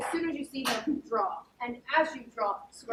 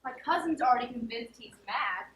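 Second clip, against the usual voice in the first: normal talking voice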